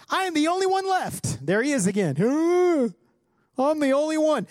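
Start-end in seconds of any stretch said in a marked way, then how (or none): 0.1-1.4 hammed-up lament; complaining prophet. Acting out Elijah's complaint. 2.2-2.9 high, loud wordless whine; exaggerated high moan; mock wail. Mocking Elijah's self-pity. 3.6-4.5 high, whiny mock lament; exaggerated high-pitched complaint; complaining prophet. Acting out Elijah's complaint.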